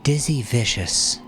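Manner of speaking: lightly voiced freed captive; timid captive introduces herself. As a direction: say her name like a secret revealed. D V speaks first time.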